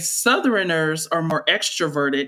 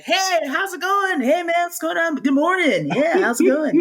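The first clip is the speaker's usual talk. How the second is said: bright, higher, chatty hellos to everyone; friendly Southern greeting; 10 semitones higher than usual. Retold or imitated. Storyteller acting out a cheery Southern neighbor, upbeat higher voice.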